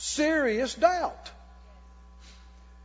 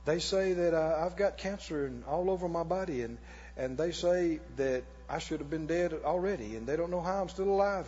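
imitated sick testifier; 6 semitones lower, 8 dB quieter than usual. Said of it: "And people would say".

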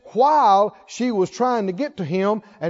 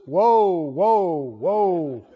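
lower, slow, ominous woe; mock doom prophet; 6 semitones lower, much slower than usual. Preacher mocking negative Christians with lower, slow doom. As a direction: intone woe like a gloomy prophet. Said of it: "they'll try to find one that says".

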